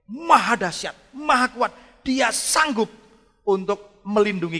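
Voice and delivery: loud, high-pitched pulpit voice; preaching at full force. Acted. Preacher booming to his congregation in full force. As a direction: proclaim it to the congregation. Preaching climax, shouted praise.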